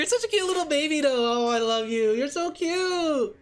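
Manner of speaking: high, slow cooing to a puppy. Real, not acted. Baby talk to her dog.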